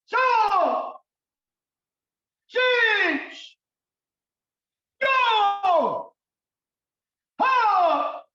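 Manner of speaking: loud, high kiai counting, each number barked out; shouted count. Shown as a demonstration. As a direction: shout every count with full force. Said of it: "encore une fois, plus fort".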